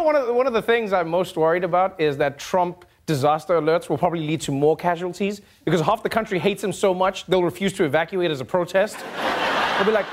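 ordinary talk

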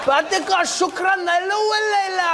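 high, slow gibberish Arabic as Trump; high hammed-up fake Arabic rant; mock Arabic. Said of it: "He'd be like".

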